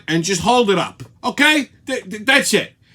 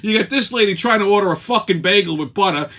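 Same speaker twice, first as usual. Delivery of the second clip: normal talking voice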